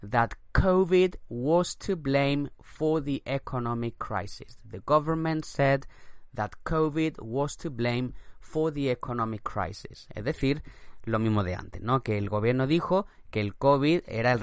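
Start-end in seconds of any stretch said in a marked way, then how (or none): 0.0-4.6 slow model sentence. Shown as a demonstration. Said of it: "teacher models example". 4.7-10.1 slow English repeat; slow dictation read. Shown as a demonstration. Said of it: "teacher repeats example".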